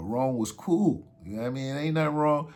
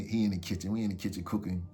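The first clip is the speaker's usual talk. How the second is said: usual speaking voice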